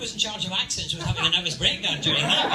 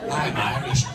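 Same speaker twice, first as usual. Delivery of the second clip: Sean Connery; 4 semitones lower, 4 dB louder than usual. Acted. Scottish actor playing an Irishman, low Connery impression. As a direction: lower mock-Scottish film-star voice. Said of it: "he still sounded like Sean Connery".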